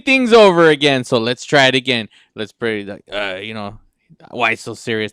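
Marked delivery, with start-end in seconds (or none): none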